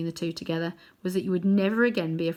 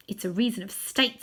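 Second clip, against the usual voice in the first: plain reading voice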